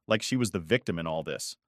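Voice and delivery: usual speaking voice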